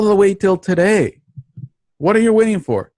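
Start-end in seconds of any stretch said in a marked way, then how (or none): none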